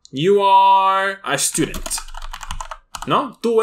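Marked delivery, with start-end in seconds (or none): 0.1-1.9 drawn out, each word held; slow model sentence. Shown as a demonstration. Teacher slowly modeling for students. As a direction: say it slowly for the class. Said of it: "You are, em".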